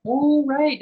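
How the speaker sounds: high loud oh right; mock surprised realization. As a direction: act out her sudden oh. Cafe lady realizing.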